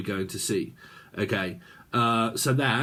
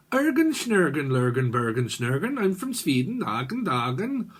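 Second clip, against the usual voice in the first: mock Swedish; 2 semitones higher than usual. Psychic playing someone from Sweden, mock Swedish accent. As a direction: put on a silly Swedish accent, pitched up.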